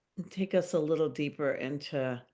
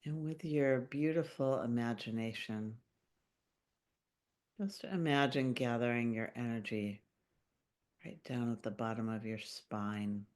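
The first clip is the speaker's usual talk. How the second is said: calm meditation voice with long pauses; unhurried, gently guiding listeners into their bodies; guided meditation; 4 semitones lower, 4 dB quieter than usual. Acted. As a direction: lead a grounding visualization, phrase by phrase, leaving long silences. Leads a grounding meditation.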